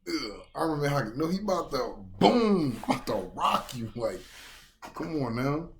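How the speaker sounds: loud, high hype; hyped. Real, not acted. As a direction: shout it like a fight fan. Hyped reaction to the hit.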